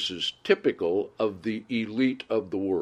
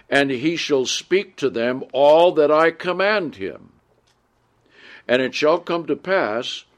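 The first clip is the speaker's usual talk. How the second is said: louder, measured Bible reading voice; scripture reading; 5 semitones higher, 5 dB louder than usual. Acted. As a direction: read the verses out to the congregation with weight. Reads scripture aloud.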